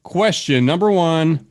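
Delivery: normal talking voice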